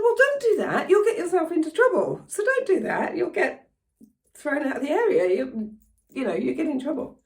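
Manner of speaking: high-pitched mock telling-off; scolding. Warning the young woman about trouble, acted scolding.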